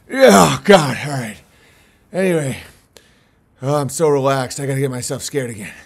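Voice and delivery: ordinary talk, nothing marked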